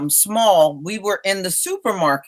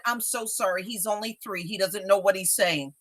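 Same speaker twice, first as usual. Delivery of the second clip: hushed, sheepish apology; mortified parent speaking very softly; embarrassed apology; 2 semitones lower, 11 dB quieter than usual. Retold or imitated. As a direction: drop right down and apologize under your breath. Embarrassed mother apologizing to stranger.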